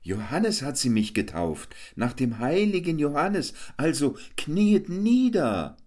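high, pompous jester, slowing at the end; jester mock proud. A narrator's reading. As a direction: demand they kneel, grandly.

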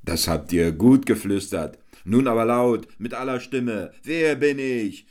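high, boisterous clown hamming; Hans Wurst the clown. A narrator's reading. Hans Wurst boasts to the crowd, high and boisterous. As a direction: work the crowd like a fairground clown.

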